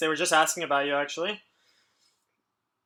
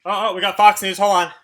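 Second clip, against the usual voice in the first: surprised; 3 semitones higher, 6 dB louder than usual. Real, not acted. Spots Fox News in chat.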